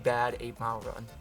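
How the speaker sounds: usual speaking voice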